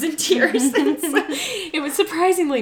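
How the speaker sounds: embarrassed happy laughter; laughing. Real, not acted. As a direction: giggle while confessing the tears. Admitting she cried at film.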